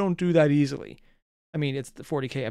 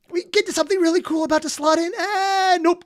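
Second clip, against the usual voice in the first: high-pitched squeal of thwarted excitement; exasperated complaint; 13 semitones higher, 3 dB louder than usual. Real, not acted. Reactor describing being yanked away from the story, high exasperation. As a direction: pitch it way up, then cut it off.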